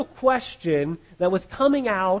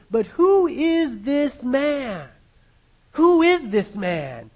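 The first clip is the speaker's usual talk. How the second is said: slow, higher, wondering; Herod's puzzled question; 6 semitones higher, 4 dB louder, much slower than usual. Acted. Preacher voicing Herod wondering about Jesus, slow and higher. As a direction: draw out the question slowly. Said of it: "Herod said".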